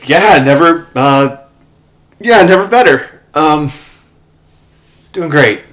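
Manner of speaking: loud, high, overly bright denial; forced cheer. Acted. Bats lying to the knight about being fine, forced cheer. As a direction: insist you're fine, far too brightly. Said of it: "quietly kicking the photo".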